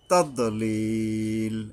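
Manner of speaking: slow "তাদলিল" with a heavy dad; drawn-out "তাদলিল"; careful articulation. Shown as a demonstration. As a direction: recite "তাদলিল", placing the d and holding the last i long. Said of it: "এই দদ উচ্চারণে".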